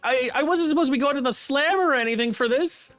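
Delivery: panicked Morgana. Acted. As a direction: spiral into fear of jail. Morgana panics about the cops.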